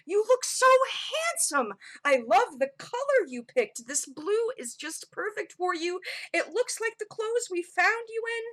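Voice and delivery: high, gushing parental voice; doting excited god. Acted. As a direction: fuss proudly over a beloved child.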